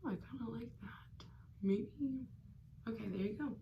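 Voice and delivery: unvoiced whisper to yourself. Real, not acted. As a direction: murmur it, unsure. Musing to self over blush.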